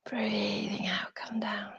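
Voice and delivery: slow, hushed, trailing away; soft exhale cue. Shown as a demonstration. Yoga teacher guiding the class's out-breath, soft and slow. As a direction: draw it out softly like a long exhale.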